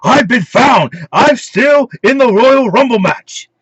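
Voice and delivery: high, hammed-up wrestler protest; mock wrestler yelling his catchphrase; Curtis Axel. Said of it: "And Curtis Axel".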